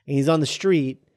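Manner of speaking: ordinary delivery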